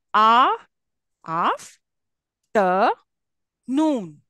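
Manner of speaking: afternoon broken up as a, af, ter, noon with pauses; syllable by syllable. Shown as a demonstration. Teacher breaking a word into syllables for students. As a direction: spell out af ter noon one beat at a time. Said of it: "Did you see the breakup of the word?".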